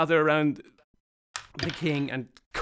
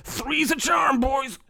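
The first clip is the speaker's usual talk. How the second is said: character voice; 9 semitones higher, slower than usual. Acted.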